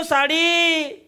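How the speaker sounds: Sari stretched very long and high; drawn-out hawker call. Retold or imitated. Hawker at the gate dragging out a long sari. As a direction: sing out sari slowly down the lane. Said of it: "बाहेरून आवाज दिला".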